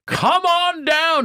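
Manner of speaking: over-the-top TV announcer hype; mocking game show host. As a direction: belt it out to mock the nervous new guy. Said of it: "He starts mocking me".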